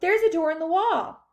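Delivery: high, loud exclamation; excited cry. A narrator's reading. Charlie showing Grandpa the door, excited. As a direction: announce the find eagerly. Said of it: "cried Charlie".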